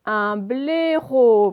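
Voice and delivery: "Un blaireau" very slow, loud and stretched; syllable by syllable. Shown as a demonstration. Teacher showing O at the end, syllable by syllable. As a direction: draw out both syllables of "blaireau". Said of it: "à la fin".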